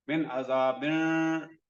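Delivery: मिन अजाबिन recited slowly, with the noon of अजाबिन hummed nasally; slow recitation with a ghunna hum. Shown as a demonstration. Teacher recites मिन अजाबिन for students, slow with nasal ghunna. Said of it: "गुन्ना पे करना है".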